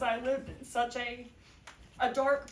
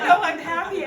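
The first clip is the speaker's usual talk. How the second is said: loud, high, laughing reply; answering her husband, laughing through happy tears; 5 semitones higher, 11 dB louder than usual. Retold or imitated. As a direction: laugh it out while tearful. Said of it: "And I'm like".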